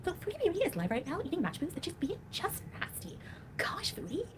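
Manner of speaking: high, slow mocking voice; mocking fake voice. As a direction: parody her sweet fake tone. Imitating a creator's video.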